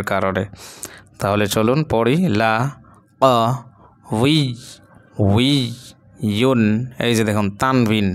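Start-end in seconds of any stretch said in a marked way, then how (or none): 2.4-6.6 লা, ক, উই, উই, ইউন, each syllable apart; broken syllable-by-syllable reading. Shown as a demonstration. Teacher reads along with learners, broken syllables spaced apart. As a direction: sound out each piece with a clear pause after it. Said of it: "ভাঙা ভাঙা উচ্চারণ আমরা পড়ি".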